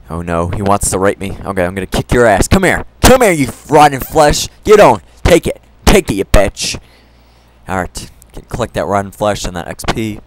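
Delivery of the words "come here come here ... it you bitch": loud taunting yells at the zombie; aggressive battle taunts. Real, not acted. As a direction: bellow insults while hacking away. Attacking a zombie.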